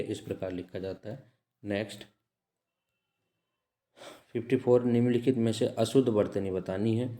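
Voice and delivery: usual speaking voice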